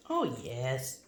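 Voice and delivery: usual speaking voice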